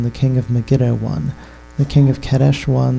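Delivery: normal talking voice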